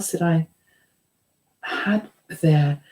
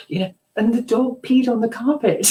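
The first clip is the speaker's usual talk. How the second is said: ordinary talk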